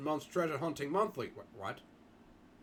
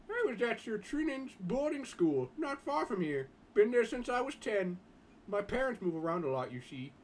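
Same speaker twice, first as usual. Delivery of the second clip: schoolboy Jim; 7 semitones higher than usual. Acted. Boy Jim telling the others about his school, higher boyish voice. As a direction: lift the voice for a polite teenage schoolboy.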